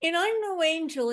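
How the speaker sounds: usual speaking voice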